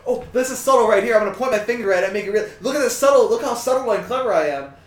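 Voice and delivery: high, loud, smug sing-song mockery; mock smug filmmaker. Mocking the filmmakers, smug and loud. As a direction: preen about your own cleverness.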